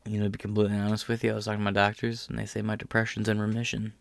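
hushed, fast, mock-earnest reply; mock sincere answer. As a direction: play it falsely earnest, quiet and quick. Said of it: "I'm gonna say that now".

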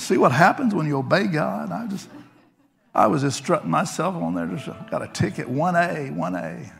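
normal talking voice

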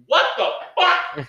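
very high, slow hammed-up shriek of disbelief through laughter; mock shocked shout. A passerby at Rockefeller Plaza gapes at the statue, hammed-up shriek. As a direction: squeal it like a baffled passerby, laughing.

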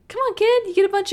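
exasperated. Pretend. Scolding the bratty kid.

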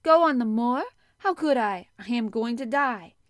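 very loud, high indignant question; startled outburst. A narrator's reading. Startled sick boy answers girl, in loud outburst. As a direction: burst out loudly at the very idea. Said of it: "as if he were startled".

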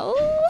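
high, squeaky embarrassed laugh; rueful laugh. Real, not acted. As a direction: laugh it off awkwardly. Laughs at her love life.

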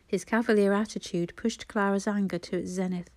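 ordinary delivery

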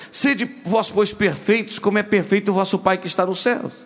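lifted, solemn reading of the verse. Acted. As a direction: read it up and out to the congregation. Reads scripture aloud.

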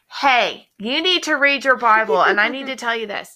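loud, high call across the room; yelling. Retold or imitated. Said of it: "yelling across the room going".

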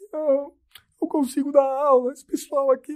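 high-pitched imitation of a crying teacher. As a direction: squeak it out like the tearful teacher. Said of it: "a professora chorando". (imitando a professora chorando, voz bem aguda)

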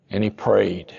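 usual speaking voice

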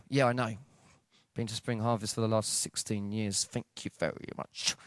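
low, quiet, offhand reply; dismissive brush-off. Retold or imitated. Preacher brushing off God's love, dismissive. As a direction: fade away into a muttered polite refusal.

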